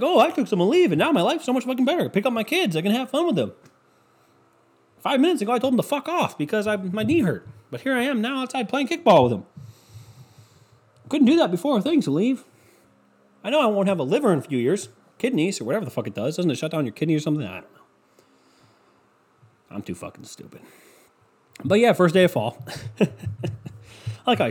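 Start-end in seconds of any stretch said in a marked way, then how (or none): 0.0-17.5 much higher, beaming ad voice; mock happy ad customer. Mocking a smiling painkiller commercial, high gushing voice. 17.5-20.6 sudden whispered, much softer; mock dumb aside. Ad character admitting stupidity, whispered.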